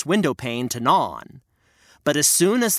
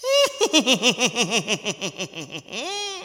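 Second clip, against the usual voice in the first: witch cackle; 6 semitones higher than usual. Acted. Witch gloats over the beds, evil cackle. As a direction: cackle over the sleeping children. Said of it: "cackled to herself".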